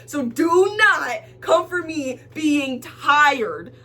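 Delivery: slow, weighty warning leaning on "do not"; emphatic telling-off. Retold or imitated. Tired customer to cashier, emphatic telling-off. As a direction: DO NOT drawn out and punched.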